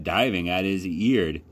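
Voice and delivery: ordinary delivery, nothing marked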